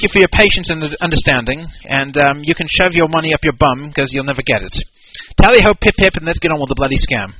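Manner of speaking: a hint of mock British; cheeky British sign-off, lightly done; understated British mockery; British accent. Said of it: "I gotta do this in the right accent".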